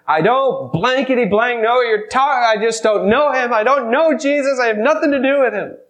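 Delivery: loud, high, furious swearing denial; cursing denial. Retold or imitated. Peter loudly cursing and denying he knows Jesus. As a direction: shout it like a cornered man cursing. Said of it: "calls down curses upon himself".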